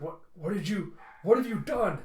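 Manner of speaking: rising into a frantic yell; panicked shout. Acted. Ozris panics at the invasion.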